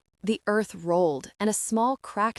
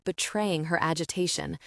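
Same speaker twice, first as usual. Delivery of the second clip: ordinary delivery, nothing marked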